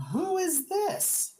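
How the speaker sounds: high, slow, irritated lord demanding who this is; a high irritated snap from an annoyed noble. Acted. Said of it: "And he goes".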